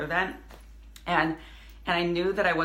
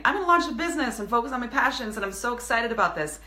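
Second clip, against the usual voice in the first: lightly voiced excited beginner, higher and quicker; imitating eager newbie; 6 semitones higher, faster than usual. Entrepreneurs dreaming of launch, mock eager voice. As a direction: bubble up like someone just starting out.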